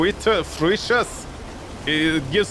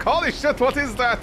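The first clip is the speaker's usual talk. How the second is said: higher shocked cry at a strange creature; startled wolves; 8 semitones higher than usual. Pretend. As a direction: wolves jumping back in alarm.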